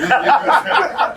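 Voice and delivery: high unvoiced burst of laughter; real high laugh. Real, not acted. Laughing at his friend's tall tale, a high laugh. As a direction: crack up at the story.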